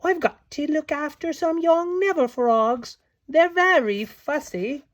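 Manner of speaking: a fairy explaining her chores; Beck's voice. A narrator's reading. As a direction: fret about fussy frogs. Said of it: "said Beck".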